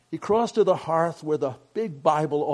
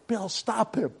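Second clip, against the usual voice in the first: higher-pitched urgent order, not shouted; contained, pressing command; 6 semitones higher, slower than usual. A narrator's reading. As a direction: give an urgent fatherly command. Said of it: "Father commanded".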